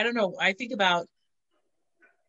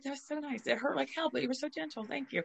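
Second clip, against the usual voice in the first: much softer, higher thanks; soft higher gratitude to the gentle nurse; soft thankful murmur; 5 semitones higher, 10 dB quieter than usual. Retold or imitated. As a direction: murmur your thanks as if still tearing up. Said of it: "And I was like".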